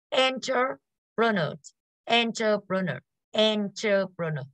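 "Entrepreneur" slowly, part by part; ơ sound. Shown as a demonstration. Teacher drills the class on a hard word, slow "Entrepreneur". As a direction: draw out "Entrepreneur", with ơ-like vowels after EN. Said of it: "Sẽ là âm ơ như cả lớp".

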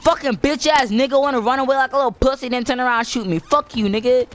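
high, fast, heated rant; angry rant. Real, not acted. Gamer cursing the enemy who shot him, angry rant.

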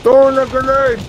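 loud warning callout; grenade warning yell. Real, not acted. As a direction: shout it to your squad. Calling out in combat.

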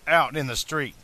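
ordinary delivery

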